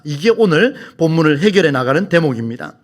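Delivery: normal talking voice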